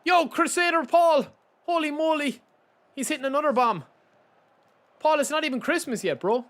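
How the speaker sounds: high, loud amazed exclamation; excited shout. Real, not acted. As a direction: hype up a generous supporter at full volume. Reacting to a big donation.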